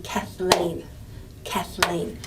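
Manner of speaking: Kathleen with the weight on "leen"; stress on second syllable. Shown as a demonstration. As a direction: lean on the end of Kathleen. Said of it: "you'd clap on the lean".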